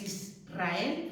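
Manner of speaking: "Israel" slowly, with a trilled R; "Israel" drawn out as is-rrael, R vibrating; strong R. Shown as a demonstration. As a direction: roll the R firmly after the S in "Israel". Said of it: "Es Israel".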